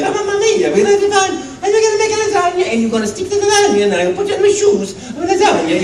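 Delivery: very high, giddy Italian cartoon voice; quick, playful falsetto with Italian accent; Mario. Keeps going at the audition, high giddy Italian character. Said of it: "talking like".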